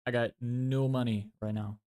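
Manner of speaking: ordinary delivery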